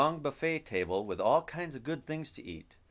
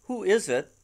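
higher, louder call through a closed door; 7 semitones higher, 8 dB louder than usual. Acted. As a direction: ask loudly from behind the door. Voice behind the door.

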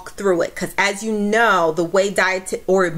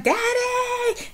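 put-on child calling daddy. Scared girl pleads with her father, high and whiny. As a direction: whine for dad like a scared kid. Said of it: "And I was like".

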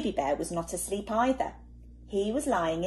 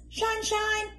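high, lilting "shine"; cheerful high call; bright sing-song; 14 semitones higher than usual. Acted. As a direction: sing it out like the sun. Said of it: "went the sun".

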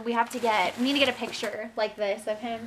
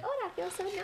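voicing the baby; 8 semitones higher, 8 dB quieter, faster than usual. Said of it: "He's like".

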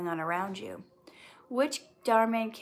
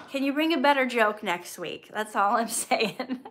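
usual speaking voice

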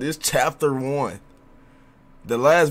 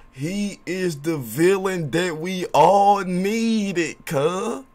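hype shout-out; 5 semitones higher, 3 dB louder, much slower than usual. Real, not acted. Hyping the New York villain.

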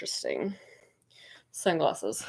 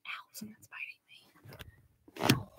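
whisper; 12 dB quieter, much slower than usual. Real, not acted. Bitten while searching the garage.

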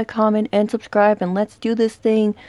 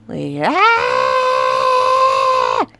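sudden high scream cut short; jump-scare shriek; startled scream. Real, not acted. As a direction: yelp in real fright. Jump scare in horror game.

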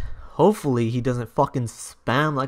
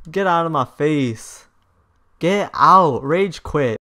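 ordinary talk, nothing marked

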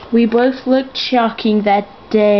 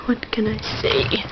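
whisper; 6 dB quieter than usual. Pretend. Repeats line as whisper.

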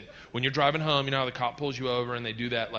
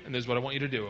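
normal talking voice

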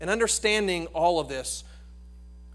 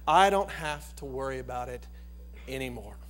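sincere and quiet; 6 semitones lower, 4 dB quieter than usual. Acted. Preacher leading the flock to trust the shepherd, quiet sincere. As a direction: let it settle in softly. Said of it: "we ought to be able to say tonight".

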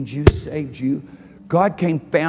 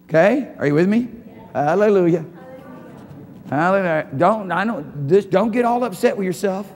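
usual speaking voice

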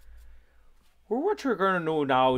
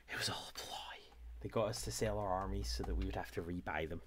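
whispered like a sly secret; conspiratorial whisper; 4 semitones lower, 10 dB quieter, faster than usual. Pretend. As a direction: lean in and whisper the plot. Mock conspiracy reveal.

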